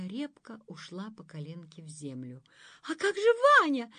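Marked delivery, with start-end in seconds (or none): 2.8-3.9 high, loud alarmed cry; exclaiming. A narrator's reading. Dunka crying out in alarm about Vanya. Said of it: "воскликнула Дунька".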